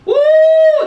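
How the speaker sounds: high, loud church whoop; joyful whoop. Real, not acted. Pastor whoops as the verse blesses her. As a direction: let a burst of joy fly out. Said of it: "That blessed me out of my socks".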